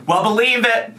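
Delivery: out of breath and panting; breathless after a short workout. Real, not acted. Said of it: "I'm a little out of breath".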